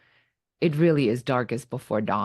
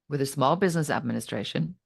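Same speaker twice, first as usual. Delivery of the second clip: ordinary talk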